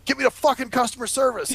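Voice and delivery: mild mock fury; cussing demand. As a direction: snap at the phone. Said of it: "I'll start cussing".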